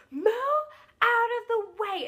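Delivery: loud, high, drawn-out imitation of an order; commanding yell. Security guard clearing girls from the front, commanding yell. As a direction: yell it slowly and forcefully, acting the guard.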